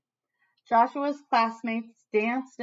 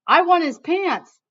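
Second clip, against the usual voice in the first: put-on louder, higher kid's yell; 7 semitones higher, 9 dB louder than usual. A narrator's reading. Kid in the crowd yelling for his pants, eager shout. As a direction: holler it like a greedy fan. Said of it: "even yelled".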